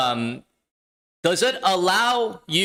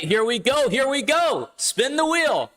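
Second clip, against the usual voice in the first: hyped giveaway announcement; 6 semitones higher than usual. Real, not acted. Spinning giveaway wheel live.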